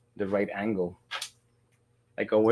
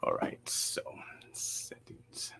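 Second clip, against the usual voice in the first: whispering; 14 dB quieter, slower than usual. Real, not acted. Muttering to self while adjusting.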